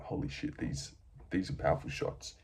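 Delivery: stunned whispered inner thought; awed whisper under your breath. Retold or imitated. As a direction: voice a dazed fighter's hushed thoughts. Voicing the stunned fighter's thoughts.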